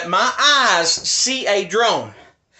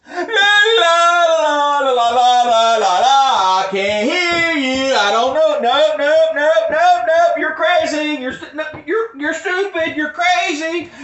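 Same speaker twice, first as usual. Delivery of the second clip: mocking la la la; 6 semitones higher, slower than usual. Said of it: "and they do this".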